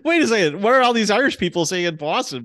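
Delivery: high and loud, laughing through it; amused shock, words tumbling out; laughing about Boston having no Irish people; laughing disbelief. Real, not acted.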